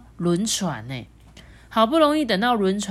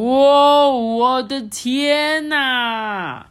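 loud, high, long-drawn astonishment; amazed exclamation; 6 semitones higher, 8 dB louder, much slower than usual. A narrator's reading. Monkey sees the huge penguin family, amazed. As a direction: gasp out a huge drawn-out wow.

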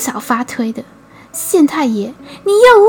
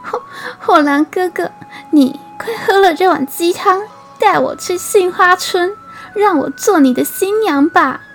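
choked up; 8 semitones higher than usual. A narrator's reading. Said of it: "哽咽着说".

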